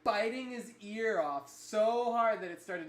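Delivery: loud, high, racing excitement; excited. Real, not acted. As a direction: tell it at the top of your voice. Excited climax of fight story.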